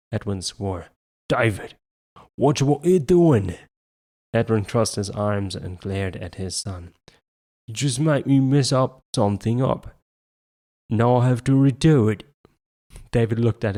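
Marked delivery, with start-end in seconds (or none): 1.3-12.2 angry scolding. A narrator's reading. Father angry at son.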